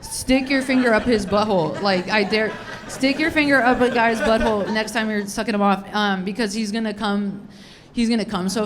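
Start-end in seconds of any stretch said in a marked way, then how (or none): none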